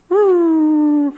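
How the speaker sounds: high, loud imitated wail; mock bawling. Moms breaking down as prayer begins, mock bawling. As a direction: wail like a distraught mom, hammed up. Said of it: "she just starts bawling".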